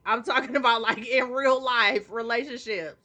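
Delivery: usual speaking voice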